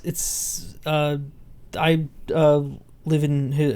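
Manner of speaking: normal talking voice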